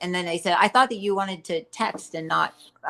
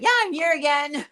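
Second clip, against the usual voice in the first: high, louder retold announcement; mock-chirpy greeting; 9 semitones higher, 4 dB louder than usual. Retelling her reply to door-to-door missionaries, mock-chirpy. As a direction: call it out high, half in jest.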